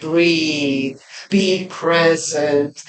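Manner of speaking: calm her down gently, breath by breath; Sandra's soothing voice. Retold or imitated. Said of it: "and just says".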